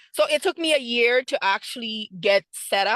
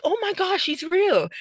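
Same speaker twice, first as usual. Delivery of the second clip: much higher, astonished gasp of joy; amazed disbelief; 8 semitones higher than usual. Retold or imitated. Realizing the buyer's call is real, astonished. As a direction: she can't believe it's true. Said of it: "I'm like".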